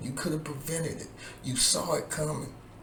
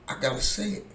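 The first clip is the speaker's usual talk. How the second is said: emphatic declaration; 6 semitones higher, faster than usual. Real, not acted. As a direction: declare it boldly before the repeat. Repeats line, then whispers it.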